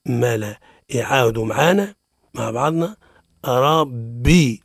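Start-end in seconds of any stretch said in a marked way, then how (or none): 3.5-4.6 slow rabbi with doubled b and drawn-out "bii"; ربي held slowly on the kasra; clear Arabic rabbi. Shown as a demonstration. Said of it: "أما في العربية با بي".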